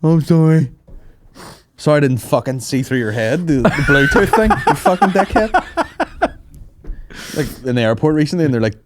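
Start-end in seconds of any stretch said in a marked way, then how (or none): 0.0-6.3 Marlon Brando voice. Pretend. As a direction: sneer the insult out slowly. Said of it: "it's my Brando impression".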